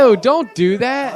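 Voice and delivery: high, loud, drawn-out disgust. Real, not acted. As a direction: recoil in disgust. Disgusted at spit cup.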